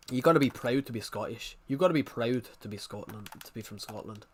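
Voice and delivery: be proud and Scottish; Scottish accent. Pretend. A lightly Scottish voice proudly praising Scotland to a Scottish chatter.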